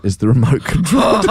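ordinary talk

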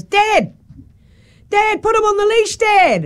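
put-on high child's voice calling after dad; kid calling out. Kid calling to his running dad, high and urgent. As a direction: call out to dad like a worried kid. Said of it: "and the kid goes".

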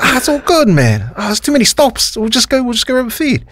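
high-pitched imitation of a friend brushing it off; dismissive mate. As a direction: wave the request away, pitched well up. Retold friend refusing to stop.

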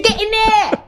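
high-pitched, hammed-up English accent; put-on English accent. Shown as a demonstration. Radio host showing off the voice that gets her coffee, comic English accent. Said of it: "when I use that voice".

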